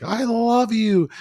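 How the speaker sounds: hammed-up high slow wail; mock screaming. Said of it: "Ed starts screaming".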